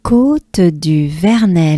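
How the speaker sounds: Côte du Vernet, slow and clear; careful teacher pace; slow model. Shown as a demonstration. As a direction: say it slowly. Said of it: "Let's take a good breath".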